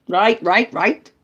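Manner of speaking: loud, pushy repeated prompting; bossy. Real, not acted. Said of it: "I'm getting really bossy".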